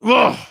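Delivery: short, high, loud groan of frustration; a sharp "ugh" forced out; exasperated groan. Real, not acted. Host stung by "but you lost", exasperated groan.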